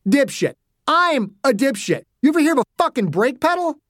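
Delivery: incredulous echo of the insult, climbing high; enraged. A narrator's reading. Father to driver who insulted him, enraged. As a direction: throw the insult back in outrage. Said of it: "He was already too far gone".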